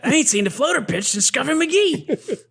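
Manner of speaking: high mock coach voice; Brickma voice. Topping his friend's quote in a high Brickma voice. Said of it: "Yeah, yeah, I got it".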